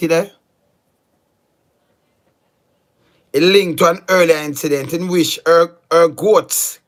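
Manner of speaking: loud, emphatic, near-shouting; heated loud rant. Real, not acted. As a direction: hammer each point home at full volume. Loud heated rant reading news.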